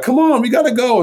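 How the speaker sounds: imitated high-pitched hurrying-up; impatient sister. Older sister urging her dawdling brother to school, impatient. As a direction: call it out high, mimicking a sister in a rush.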